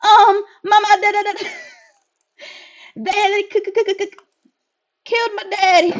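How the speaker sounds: put-on high child voice, stammering through shock; stuttering child. Child telling the player her father was killed, stammering. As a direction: choke on the words like a frightened kid.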